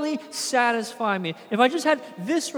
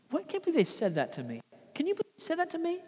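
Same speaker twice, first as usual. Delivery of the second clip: mock offended grumble; 6 semitones higher, 6 dB quieter, faster than usual.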